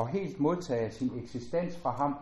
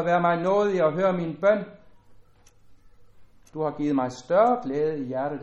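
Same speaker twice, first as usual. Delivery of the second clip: louder, declaiming psalm voice; solemn scripture reading; 5 dB louder than usual. Acted. Preacher reading a psalm to the congregation, solemn and louder. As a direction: lift the psalm out to the hall, solemn and full.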